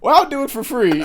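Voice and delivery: high, loud hammed-up giving in; quoted character voice. Retold dancer dropping his price to free, high put-on voice. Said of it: "I'll do my special Gwibbly dance".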